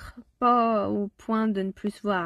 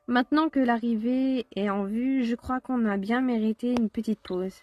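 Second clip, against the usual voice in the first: usual speaking voice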